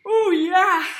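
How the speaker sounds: spoken through a yawn. Real, not acted. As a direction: stretch it out mid-yawn. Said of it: "Sorry, I'm yawning".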